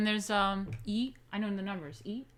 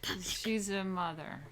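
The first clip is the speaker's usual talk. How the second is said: ordinary talk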